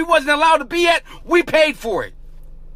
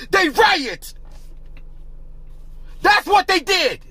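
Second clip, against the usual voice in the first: heated shout, pitched higher; angry shouting; 6 semitones higher than usual. Real, not acted. Vlogger rants at viewers about the riots, shouting higher. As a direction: yell it out in outrage.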